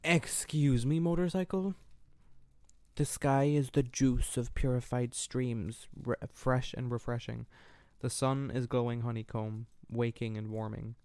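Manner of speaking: soft, slow poem reading. Acted. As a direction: read it gently, letting lines fall. Reading her own poem aloud.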